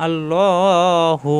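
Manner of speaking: আল্লাহু with the ল held one alif long; one alif stretch. Shown as a demonstration. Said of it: "এক আলিফ টান". (আল্লাহু শব্দে এক আলিফ টান)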